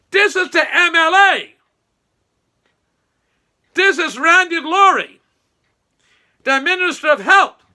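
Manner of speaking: mock shout. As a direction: bellow each word like an offended big shot. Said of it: "I'm gonna repeat that".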